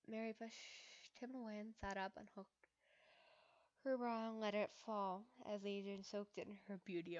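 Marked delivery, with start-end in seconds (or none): none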